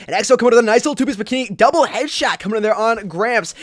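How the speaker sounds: fast, high, loud play-by-play; hyped caster shouting out a headshot; excited kill call. Real, not acted. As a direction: burst into a big highlight call. Calling a double headshot.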